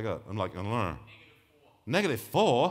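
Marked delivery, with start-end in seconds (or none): 1.9-2.7 louder and higher, caught off guard; surprised exclamation. Real, not acted. Presenter reading an odd slide number aloud, surprised.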